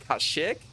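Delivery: Kashyyyk as kah-SHICK, broad ah first; questioning Kashyyyk, kah then short-i shick; short-i pronunciation. Shown as a demonstration. Unsure streamer to viewers, Kashyyyk said kah-SHICK. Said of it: "not knowing how to say".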